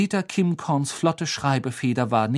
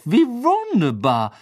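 high, slow gushing; stretched-out, showy delight; beaming delight; 8 semitones higher, 4 dB louder, slower than usual. A narrator's reading. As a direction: gush through a broad smile. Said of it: "setzte ein strahlendes Lächeln auf".

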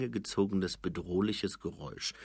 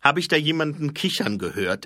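higher, louder suspicious question; Fred suspicious of giggling; 8 semitones higher, 8 dB louder than usual. Acted. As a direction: sniff out the giggler. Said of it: "erkundigte sich Kollon argwöhnisch".

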